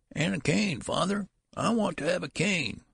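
put-on very high old-man voice; high-pitched querulous demand; old man calling after. A narrator's reading. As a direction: call after him in a high whine. Old man calls down the hall.

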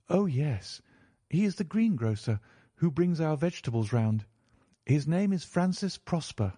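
name the man helpfully as the niece; forthcoming niece. A narrator's reading. Mary identifies the man.

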